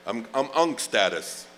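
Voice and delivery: ordinary talk, nothing marked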